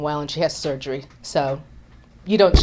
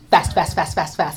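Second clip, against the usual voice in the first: rapid-fire. Shown as a demonstration. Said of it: "I walked like a New Yorker, just fast".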